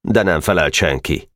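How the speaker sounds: ordinary delivery, nothing marked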